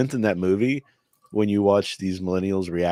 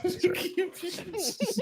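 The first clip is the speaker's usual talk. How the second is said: very high, slow falsetto resignation; mock resigned squeak; 10 dB quieter, much slower than usual. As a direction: squeak it out like a wounded martyr. Joking about losing his pay.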